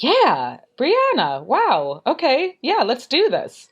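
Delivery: high, eager acted-out delight; excited reaction. Host recalling seeing guest's name in inbox, excited. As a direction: replay your thrilled reaction, pitch jumping up.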